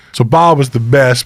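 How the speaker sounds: ordinary talk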